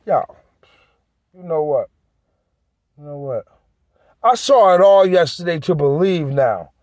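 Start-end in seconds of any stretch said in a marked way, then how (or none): 3.0-3.4 much softer, trailing off; muttered repeat fading almost to nothing; almost under his breath. Real, not acted. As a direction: say it to yourself, barely audible. Repeats the phrase, second time dropping away.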